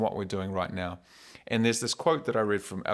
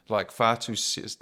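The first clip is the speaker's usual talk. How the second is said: normal talking voice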